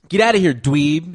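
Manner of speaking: loud, high jeering shout; mock taunt. Podcaster jeering at a player, loud mock taunt. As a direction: jeer at him like a schoolyard bully.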